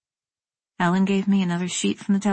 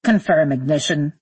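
lower-pitched astronaut radio report; 4 semitones lower than usual. Acted. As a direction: report it over the rocket's radio. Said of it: "Parker's voice crackled over the speaker".

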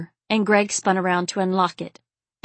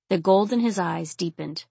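usual speaking voice

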